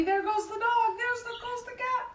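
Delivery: high, hurried storytime commotion; excited high-pitched exclaiming; mounting excitement. Acted. Everyone leaps up in story.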